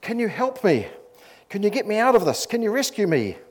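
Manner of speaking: high, urgent begging; acted pleading. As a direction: plead for rescue in mock desperation. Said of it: "The tourist pleaded".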